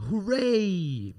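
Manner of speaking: "hooray" with a long swooping second syllable; exaggerated "ray" glide. Shown as a demonstration. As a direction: stretch the "ray" in "hooray", sweeping pitch up and down. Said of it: "Bring it ooey gooey to the middle".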